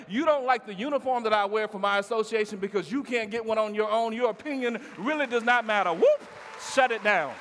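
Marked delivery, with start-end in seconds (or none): 5.9-7.3 shouted catchphrase. Acted. Speaker rallying young people, loud shouted catchphrase. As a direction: belt out the catchphrase at the peak.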